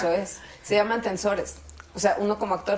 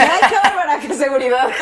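higher, louder, laughing mock brag; laughing self-praise; 5 semitones higher, 7 dB louder than usual. Singer quoting her own self-praise, laughing. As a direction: cheer yourself on with a laugh. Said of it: "porque dije".

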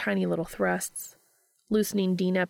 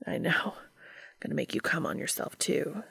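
lightly voiced hushed, teasing lover; Cass, teasing lover; 3 semitones lower, 5 dB quieter than usual. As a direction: murmur it low and whispery.

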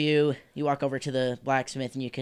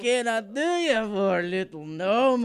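higher, slow, drawn-out Scottish dwarf; hammed-up, botched Scottish burr; bad Scottish accent; 8 semitones higher, 3 dB louder, slower than usual. Dwarf blacksmith greeting a little gnome, bad Scottish accent. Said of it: "Do Scottish".

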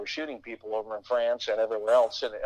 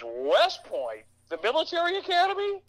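incredulous. Retold or imitated. Dad scoffing at his son's West Point dream, high incredulous. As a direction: voice leaps up on academy, disbelieving. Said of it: "my dad goes".

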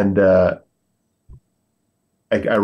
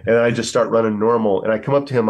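ordinary delivery

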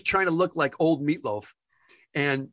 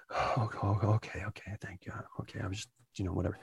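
very soft, low, near-whispered stammer; meek backing down; 5 semitones lower, 10 dB quieter, faster than usual. Retold or imitated. Caught lookalike thanking staff, meek and hushed. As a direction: shrink away, voice almost vanishing.

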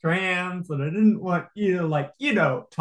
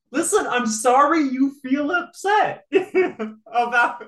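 mock fake apology; 7 semitones higher than usual. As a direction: parody a hollow sorry while cracking up. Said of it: "just like".

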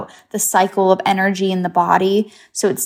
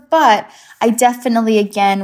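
ordinary delivery

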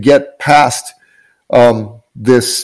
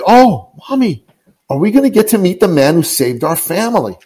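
a hint of a small child, higher at first; little boy's voice; 6 semitones higher than usual. Son asking his mother about the man, lightly voiced child's awe. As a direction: lightly play an awed little boy. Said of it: "And she said".

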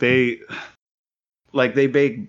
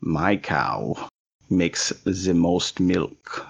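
lower, slow mock German accent; 4 semitones lower, much slower than usual. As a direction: boast about the cow in a heavy, slow German accent. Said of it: "this guy's German now".

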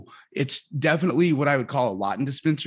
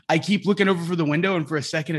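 usual speaking voice

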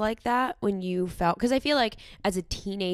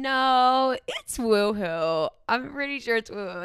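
higher, drawn-out stubborn objection; mock insistence; 4 semitones higher, 4 dB louder, slower than usual. Daughter imitating mom defending "woohoo", stubbornly insistent. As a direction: imitate someone sure of their mistake.

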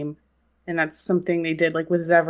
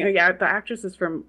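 ordinary talk

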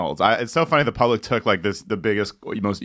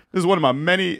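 higher, pompous lawyer voice; Jackie Chiles impression; 7 semitones higher, faster than usual. Quoting Jackie Chiles to friends, lightly pompous. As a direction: lightly play the grandstanding lawyer. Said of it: "what does he say?".

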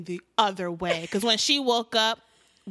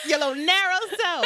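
laughing; 8 semitones higher, 4 dB louder than usual. Real, not acted. Echoing mom's scolding, laughing.

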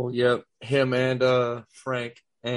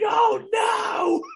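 very high, wailing mock scream; hammed-up horror-movie shriek; imitated scream; 3 dB louder than usual. Fan imitating the over-the-top victim, high mock scream. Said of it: "The way he starts screaming".

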